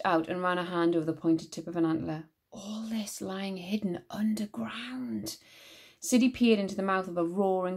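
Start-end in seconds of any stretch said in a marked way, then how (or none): 2.5-5.4 slow awed whisper. A narrator's reading. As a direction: breathe it in wonder. Awed hush in hidden chamber.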